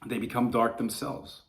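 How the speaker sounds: normal talking voice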